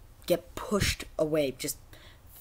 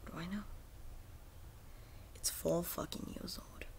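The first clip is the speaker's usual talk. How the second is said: whispered in disbelief, much softer; stunned whisper; 15 dB quieter than usual. Real, not acted. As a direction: breathe it out, stunned. Disbelief at game's age.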